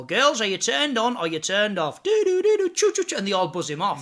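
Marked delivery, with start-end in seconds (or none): 0.0-2.0 host's question. Retold or imitated. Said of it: "And then they go". 2.0-3.2 very high loud sung buzzer noises; imitating buzzers buzzing off a contestant. Buzzer sound effect in routine.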